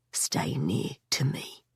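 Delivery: hushed whisper close to her ear; urgent hushed warning; low in her ear. A narrator's reading. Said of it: "he said low in my ear".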